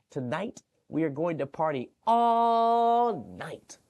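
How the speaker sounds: high, slow, loud party announcement; gleeful child shout; excited party cry. Acted. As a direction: declare an all-night party.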